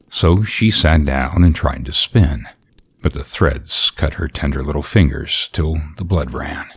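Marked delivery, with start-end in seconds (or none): none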